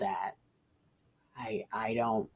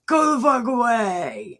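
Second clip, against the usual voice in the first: mock rebuke; 9 semitones higher, 4 dB louder than usual. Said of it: "Jesus, like".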